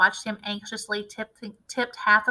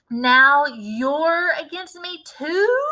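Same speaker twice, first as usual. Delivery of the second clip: slow, louder affronted grandma; indignant shout; 5 semitones higher, 7 dB louder, much slower than usual. A narrator's reading. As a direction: drag out the outrage. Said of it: "shouted Grandma".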